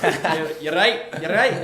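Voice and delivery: put-on jokey Glasgow accent check-in; Glaswegian accent. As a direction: toss it off in playful Glaswegian. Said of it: "I'll trick people in America".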